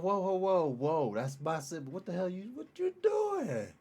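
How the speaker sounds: mock indignant "whoa, whoa", climbing high at the end; protective sibling outrage. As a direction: act like someone picking on your little brother. Protective sibling confronting outsider.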